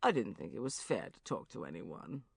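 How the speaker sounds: low, soft tired voice; wearily. Acted. Depressed friend on the phone, low, soft and weary. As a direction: drag it out, worn down. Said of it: "he said wearily".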